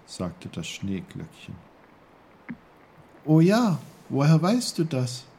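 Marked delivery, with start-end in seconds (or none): 3.3-5.3 high, loud burst of recognition; lifted, astonished question; surprised Peter. A narrator's reading. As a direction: react with amazement in a boy's voice.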